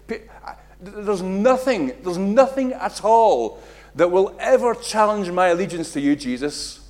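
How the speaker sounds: loud, rising declaration of loyalty; big, loud promise of allegiance; Peter's bold vow. Acted. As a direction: swear it boldly. Peter vows his allegiance to Jesus.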